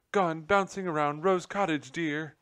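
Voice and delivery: high, loud and anxious; frantic cry. A narrator's reading. Nutmeg explaining where the general went, high and frantic. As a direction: blurt it out in a panic. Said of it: "Nutmeg cried".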